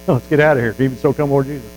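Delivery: normal talking voice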